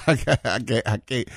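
real laugh breaking into the words. Real, not acted. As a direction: stammer it through a chuckle. Laughs at his own story.

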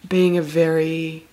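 dreamy, drawn-out and slow; blissed-out slow talk. Pretend. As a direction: drift through the words, spaced out. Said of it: "you're gonna sound exactly like that".

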